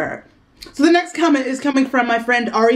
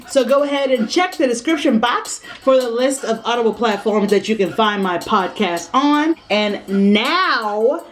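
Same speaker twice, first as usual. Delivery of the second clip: ordinary talk, nothing marked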